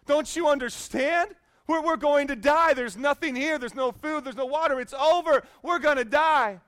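loud, high, exasperated panic; ticked off. Acted. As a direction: act a frantic castaway yelling at his calm friend. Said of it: "First guy gets ticked off".

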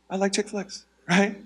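very soft, under the breath; hushed mock confession; secret admission. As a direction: sneak it out quietly. Secretly admits what he hid.

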